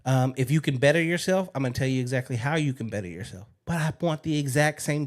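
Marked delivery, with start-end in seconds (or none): none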